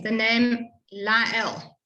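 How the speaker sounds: "Lael" drawn out slowly; slow, careful. Shown as a demonstration. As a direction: spell out "Lael" by ear. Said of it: "is difficult for people in English".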